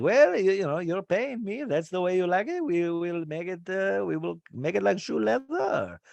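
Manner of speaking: high, hammed-up French accent; French chef accent. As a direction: chef telling guest her duck will be shoe leather, mock French. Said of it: "he's talking French".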